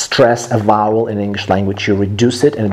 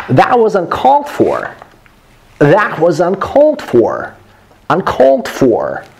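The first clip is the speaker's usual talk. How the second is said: "That was uncalled for" higher, falling away at the end; natural, expressive delivery; 5 semitones higher than usual. Shown as a demonstration. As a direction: hit "uncalled" on a high pitch, then drop on "for". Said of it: "if I have to say it naturally".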